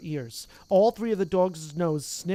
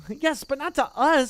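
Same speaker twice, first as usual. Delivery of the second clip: higher, louder exasperated retort; 8 semitones higher, 6 dB louder than usual. Acted. Ron fed up with Neville, exasperated retort. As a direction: throw up your hands at him. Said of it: "said Ron in exasperation".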